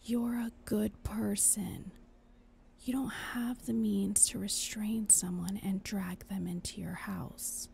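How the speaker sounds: soft unvoiced whisper; a hushed conscience pleading. Angel whispering throughout.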